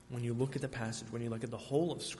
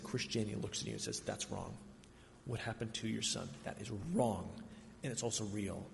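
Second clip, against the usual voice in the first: hushed voicing; 3 semitones lower, 6 dB quieter than usual. Preacher voicing Christianity to grieving father.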